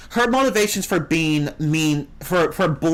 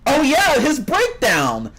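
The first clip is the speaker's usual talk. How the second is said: much higher and louder sudden outburst; delighted high-pitched exclamation; excited; 11 semitones higher, 4 dB louder, slower than usual. Real, not acted. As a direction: blurt it out as the memory hits. Suddenly remembers the villain's breakdown.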